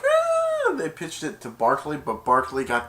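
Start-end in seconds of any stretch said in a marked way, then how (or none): 0.0-0.7 very high imitated neigh; whinny in a thin high squeal; horse whinny. Horse as the ball is pitched, high whinny. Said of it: "the horse was like".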